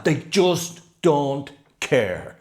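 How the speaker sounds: put-on They just don't care, slow and drawn out in a broad Cork accent; thick Cork accent. Teacher summing up his students, slow thick Cork accent. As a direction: lean slowly into the Cork accent. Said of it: "to quote him in his thick Cork accent".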